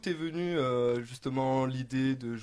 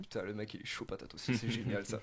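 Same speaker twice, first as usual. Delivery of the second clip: whispered aside; 6 semitones lower, 11 dB quieter than usual. Real, not acted. Joking aside about the guest.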